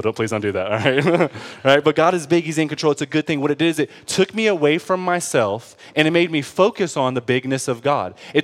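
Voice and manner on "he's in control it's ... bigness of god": ordinary talk, nothing marked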